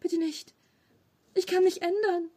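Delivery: high, rising desperate begging; whimpering plea. A narrator's reading. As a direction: beg for mercy, whining. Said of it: "Hieronimo jammerte". (jammernd und hoch flehend)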